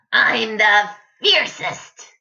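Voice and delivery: high, slow proud cub; little lion voice. A narrator's reading. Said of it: "said little lion".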